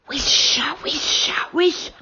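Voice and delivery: whispered, breathy tree voices; loud whisper of trees. Acted. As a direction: hiss it like rustling leaves. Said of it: "whispered the trees loudly".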